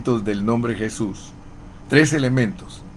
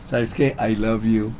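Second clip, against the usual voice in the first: tender aside; 6 dB quieter than usual. Retold or imitated. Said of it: "le dices".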